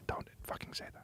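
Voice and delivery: barely audible whisper; faint, hushed ASMR question. Pretend. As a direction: whisper very softly into the mic. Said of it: "Can you do some A S M R".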